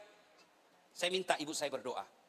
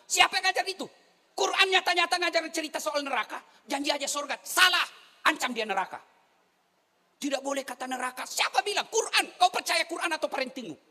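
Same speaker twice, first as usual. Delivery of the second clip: fiery preaching; 10 semitones higher, 6 dB louder than usual. Real, not acted. Preacher rails against parenting advice.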